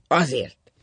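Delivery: loud, falling single word; curt answer. A narrator's reading. Husband refusing to explain to his wife, curt. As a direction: close the matter bluntly.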